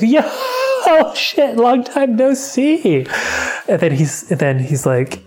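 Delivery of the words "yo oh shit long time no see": laughing greeting. Retold or imitated.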